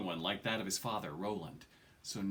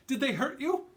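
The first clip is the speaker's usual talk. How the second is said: high, worried mother's voice; anxious mother; 4 dB louder than usual. A narrator's reading. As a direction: fuss over him, pitched high. Mother fussing over her son.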